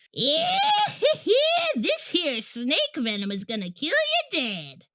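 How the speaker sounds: high cackle then rough drawl; cackle, then threaten wildly; crazy hillbilly voice. Acted. Crazy guy with needle.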